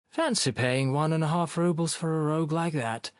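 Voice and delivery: flat voice. A narrator's reading. Onlooker in the doorway, flat-voiced. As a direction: keep it level and unimpressed. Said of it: "a flat voice said".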